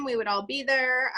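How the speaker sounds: ordinary delivery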